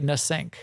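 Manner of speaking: nasync said as "nuh-SINK"; joking mispronunciation. Shown as a demonstration. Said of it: "just like you say".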